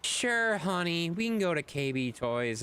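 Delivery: high sweet mock mom voice, starting very high; imitating mom. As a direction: play the indulgent mom with a sugary "honey". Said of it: "And then I would beg Mom".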